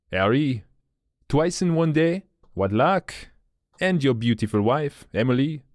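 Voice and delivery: put-on lightly French-accented apology; a mild French accent, polite. A narrator's reading. Dubois voice held.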